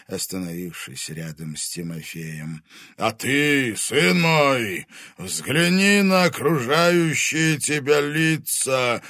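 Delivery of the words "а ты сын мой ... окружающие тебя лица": slow, coaxing dragon voice; insinuating. Acted. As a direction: coax him, sly and slow. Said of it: "вкрадчиво продолжил".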